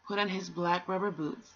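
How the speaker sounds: plain reading voice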